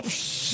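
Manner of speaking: hissed "psh" of water; imitated hose spray. Dad squirting his son down, spray noise. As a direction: mimic the hose blasting.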